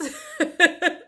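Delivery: a high, loud burst of real laughter. Real, not acted. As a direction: laugh it off at herself. Laughs at her failed plan.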